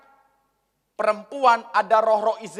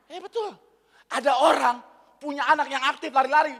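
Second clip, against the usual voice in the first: ordinary talk, nothing marked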